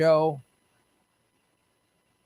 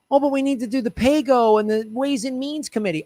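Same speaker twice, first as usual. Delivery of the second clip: higher, sing-song mocking voice; mocking whiny politician; 6 semitones higher than usual. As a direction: whine it like a stalling politician.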